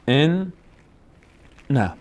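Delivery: EN, then a gap, then نه; split syllables. Shown as a demonstration. Teacher showing students a doubled nun, in separated syllables. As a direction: break ان، نه into two separate syllables. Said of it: "مثال رو با هم ببینیم".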